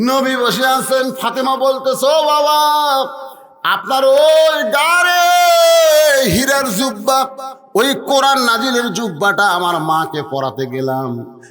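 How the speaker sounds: high, loud chanting sermon; full-force preaching. Acted. Preacher voicing Fatema calling to her father, full-force preaching. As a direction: call it out high with stretched words.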